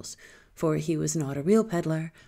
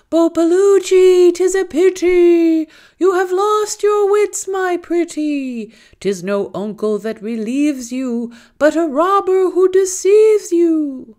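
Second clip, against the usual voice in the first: much higher and louder, slow howl; howling jackal; 12 semitones higher, 12 dB louder, slower than usual. A narrator's reading. Jackal howling its warning at the girl, high and loud. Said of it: "it began to howl".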